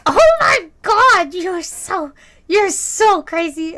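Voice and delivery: slow, high, loud gushing; tipsy old lady. Retold or imitated. Regular's wife gushes at the server, drawn-out tipsy exclamation. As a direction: imitate an amazed old lady, stretching the words. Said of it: "such a good imitation of her".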